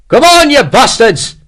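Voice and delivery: loud, high rallying yell; battle shout. A narrator's reading. Sergeant charging under fire urges his men, shouting. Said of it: "he shouted".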